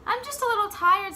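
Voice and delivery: put-on a kid covering his fear; child voice. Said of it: "said Jabari".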